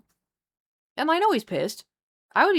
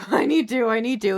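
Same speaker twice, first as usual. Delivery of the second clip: laughing; 2 semitones higher, 5 dB louder than usual. Real, not acted.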